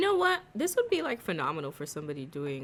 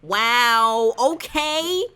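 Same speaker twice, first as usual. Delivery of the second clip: much louder, higher amazed wow; 7 semitones higher, 11 dB louder, much slower than usual. Real, not acted. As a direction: blurt out real amazement. Amazed at the game hack.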